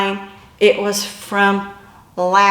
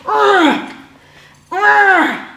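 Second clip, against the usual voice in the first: grunts; 9 semitones higher, 4 dB louder than usual. Retold or imitated. Dragged as dead weight.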